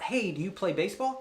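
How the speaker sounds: normal talking voice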